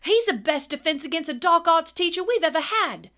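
high, loud, defiant student; bold outburst. A narrator's reading. Dean defending Lupin to Snape, bold loud outburst. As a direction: stand up for the teacher boldly. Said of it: "said Dean Thomas boldly".

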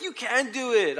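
high, exasperated insistence; retold yelling. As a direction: act out frustrated urging. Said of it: "I'm, like, yelling at her".

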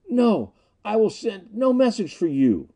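high, pained cry of refusal; anguished defiance. A narrator's reading. Snap under torture refuses the message, anguished and defiant. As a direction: cry out defiantly through the agony. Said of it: "Snap suddenly screamed, but he ended".

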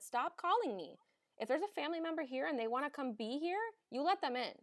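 high, fed-up command; exasperated order. Retold or imitated. Nurse scolding front desk for calling, exasperated. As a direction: tell them off for calling again. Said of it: "I said".

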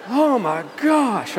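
slow, high, loud appalled gasp of words; hammed-up horrified reaction; mock horror. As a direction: stretch it out in comic dread. Horrified reaction to spider tale.

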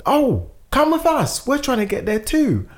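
imitating an eager friendly guy; eager invite. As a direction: sweep her along with you. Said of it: "He's like".

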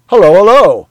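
bright, loud, high hello; cheery greeting. Acted. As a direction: greet them heartily. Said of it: "with a cheery".

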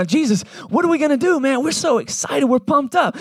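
high, eager and bubbly; giddy excitement. Retold or imitated. Disciples asking Jesus what's next, high giddy excitement. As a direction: bounce up to him thrilled.

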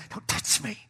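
mock-fierce whisper; whispered warning. Wife in labor pushing husband's touch away, whispered warning. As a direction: imitate a laboring wife's whispered threat. Same labor line, repeated.

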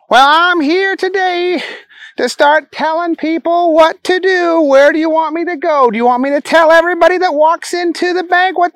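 high, silly simpleton voice; Booger. Acted.

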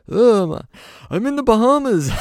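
high relaxed rocker voice. Retold or imitated. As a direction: tell him where you are, laid back and high.

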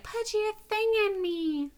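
hammed-up airhead begging; dumb-girl voice. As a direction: play her dim and needy, way up in pitch. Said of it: "dumb, so I said it like that".

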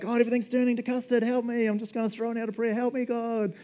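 mock panicked prayer. Believer praying in panic as everything falls apart. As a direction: throw up a frantic prayer. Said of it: "We're like".

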